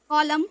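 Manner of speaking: "कॉलम" closing on m with no n; silent N. Shown as a demonstration. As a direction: say "कॉलम", ending at the m. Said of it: "एन तो silent ही रहेगा".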